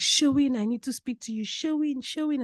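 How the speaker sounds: high pleading calls of Sherwin; calling out. Retold or imitated. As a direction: call after her, begging. Dad calling daughter from car.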